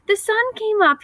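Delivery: high, bright chirping squirrel; chirpy squirrel. A narrator's reading. Happy squirrel chirping about the sunrise, high and gleeful. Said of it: "he chirped".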